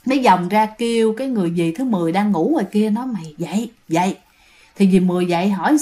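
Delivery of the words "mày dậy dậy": urgent hushed wake-up. Retold or imitated. Waking sister quietly at night.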